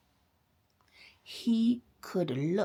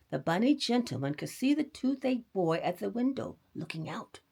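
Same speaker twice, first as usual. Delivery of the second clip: usual speaking voice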